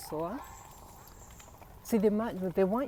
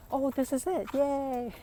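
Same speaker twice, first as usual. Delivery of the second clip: excited; 8 semitones higher, slower than usual. Real, not acted. Finds the right trail spot.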